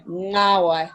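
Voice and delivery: ngawai opening with ng as in singer; initial ng. Shown as a demonstration. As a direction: start ngawai with the ng sound. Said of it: "give that a go".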